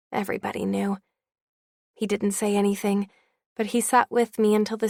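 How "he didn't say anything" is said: plain reading voice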